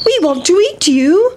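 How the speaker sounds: put-on high, swooping child-like voice; hungry little boys. Hungry boys chasing the pancake, eager demand.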